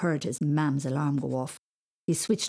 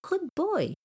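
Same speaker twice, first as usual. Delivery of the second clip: praising; 12 semitones higher than usual. Acted. Mother praising son.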